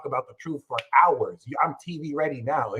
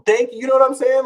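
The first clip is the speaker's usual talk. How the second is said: higher and louder, hyped up; excited; 10 semitones higher, 7 dB louder, faster than usual. Real, not acted. To his viewers, hyped and louder. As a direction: fire up the viewers. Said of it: "hyped affirmation to viewers".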